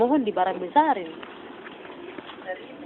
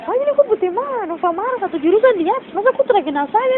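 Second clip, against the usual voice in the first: high-pitched, disbelieving protest; retold incredulity; 8 semitones higher than usual. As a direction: challenge them in startled disbelief. Retold disbelief at not being recognised. (protes heran bernada tinggi)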